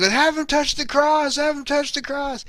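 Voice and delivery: high-pitched, insistent mock plea; imitating someone freaking out. Acts out Charlie freaking out.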